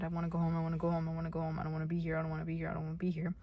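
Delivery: a hint of the panic refrain, told quietly; quiet panicked mantra. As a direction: murmur it over and over.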